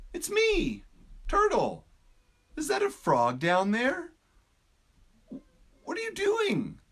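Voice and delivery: a bright, curious turtle peering in; cheerful turtle. Acted. Turtle character voice.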